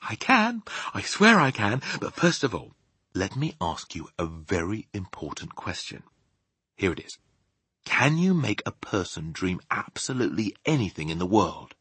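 high insistence settling to careful question; Sophie, earnest. Acted. Sophie questioning the giant about dreams, earnest. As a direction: insist high, then ask gently and deliberately.

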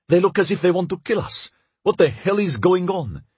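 high-pitched, alarmed character voice; frightened questioning, well above narration pitch. Acted. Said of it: "said in a frightened voice".

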